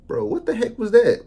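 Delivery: high, loud bro what was that; outraged high exclamation; baffled outcry. Retold or imitated. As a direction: exclaim in disbelief. Reacting to Oscar winner.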